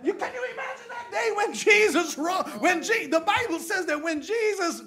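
high, loud, laughing excitement; laughing shout. Real, not acted.

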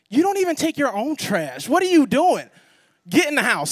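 high, loud scolding mother; mom yelling. Retold or imitated. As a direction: snap at the kids and send them inside.

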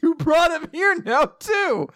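high mock-exasperated outburst; hammed-up complaint. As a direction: play up the frustration, pitched high. Bully acted out, exasperated at giant.

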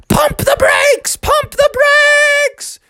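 very high, stretched-out shriek; acted screaming mother; panicked shouting. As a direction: yell frantically at the driver. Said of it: "Mother started shouting at Father".

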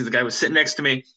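usual speaking voice

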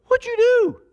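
high, loud shocked cry of "What'd you do?"; shocked wife. Retold or imitated. Pastor voicing Linda finding the cleared table, shocked and loud. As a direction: play the wife walking in on the mess, aghast.

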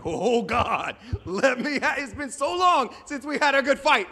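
high, laughing, mock-giddy warrior; eager battle-hunger. Warrior angels looking at the Syrians, giddily eager. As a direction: relish it with hammed-up eagerness. Acts angels itching for a fight.

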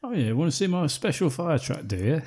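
put-on high, jokey Cockney accent; a cheeky Cockney mock of a fire truck driver; Cockney geezer. Mocking the Cockney roommate from the film, in a Cockney accent. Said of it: "some Cockney geezer".

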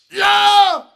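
loud, high mock yell; hammed-up pained yelp. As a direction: yelp as the band-aid rips. Ripping the band-aid off.

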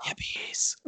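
stage whisper, forced and unvoiced; whisper scream. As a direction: scream it, but only in a whisper. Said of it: "Whisper scream ready".

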